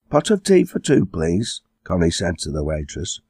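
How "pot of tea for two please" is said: lightly put-on grown-up ordering voice, starting high; all grown up. A narrator's reading. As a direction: order like a girl playing grown-up. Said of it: "sounding all grown up".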